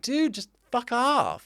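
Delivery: high, drawn-out imitated American exasperation; mock an annoyed American, slow and high; American accent. Retelling friend telling the walker off, high slow American accent. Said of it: "she speaks with an American accent".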